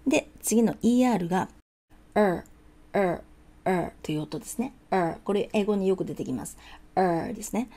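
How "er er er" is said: "er" as one muffled English r-vowel. Shown as a demonstration. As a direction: hold a dark, muffled "er". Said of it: "こもった音". (こもった音で「er」)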